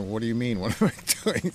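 playfully acted puzzlement, lifting in pitch; taken-aback artist. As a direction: echo the question back in amused disbelief. Artist reacts to being questioned.

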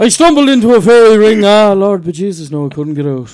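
loud, high-starting folk-singer impression. Shown as a demonstration. Giving friends a wee impression, loud Irish balladeer. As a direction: let it ring out, then settle down. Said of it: "I can give you a wee [name]".

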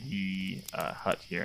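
ordinary talk, nothing marked

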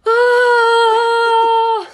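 long high squeal; loud excited shriek; delighted scream. Real, not acted. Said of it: "I'm only screaming this episode".

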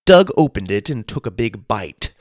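ordinary delivery

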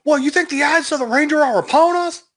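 hammy dramatic voice. Pretend. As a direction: ham it up like a TV hero. Playful dramatic quote.

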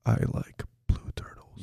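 slow unvoiced whisper; breathy slow ASMR murmur. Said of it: "say in an A S M R voice".

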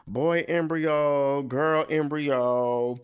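slow, drawn-out words as if writing; slow dictation. Retold or imitated. Mom writing down the list, slow deliberate dictation. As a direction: spell it out slowly while writing.

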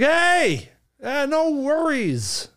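high, bright, drawn-out no worries; exclamation point cheer. Shown as a demonstration. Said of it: "with an exclamation point?".

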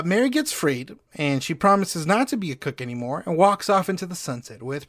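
ordinary delivery

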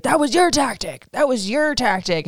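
imitating him snapping that it was her tactic; mock accusation. Imitating Tom blaming Ariana for the tactic. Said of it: "And he's like".

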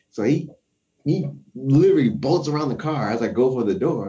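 ordinary delivery

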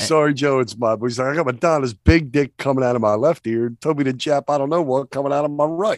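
acted film complaint. Co-host acting out a line said to Joe, worked-up complaint. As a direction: hold it high and pushed, like complaining to the boss. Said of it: "It's like".

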